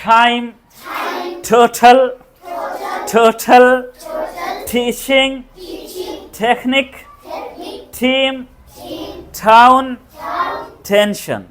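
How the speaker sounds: टाइम, टोटल, टीम with a breathy burst on T; slow model words, strong ठ-like T; aspirated English T. Shown as a demonstration. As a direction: drill each word with T puffed like ठ. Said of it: "ये ठ पढ़ने का".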